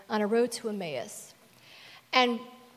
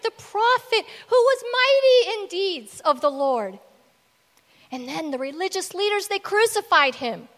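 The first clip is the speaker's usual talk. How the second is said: higher voice, swelling louder to a peak; worked-up retelling; 9 semitones higher, 6 dB louder than usual. Retold or imitated. Cleopas telling Jesus about Jesus, worked up. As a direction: build it up with mounting intensity.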